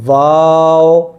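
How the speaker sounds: با and تا held long, one breath each; slow Quran teacher drawing out each letter; drawn-out letter names. Shown as a demonstration. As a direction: stretch با then تا out slowly. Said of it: "ٹھیک ہے".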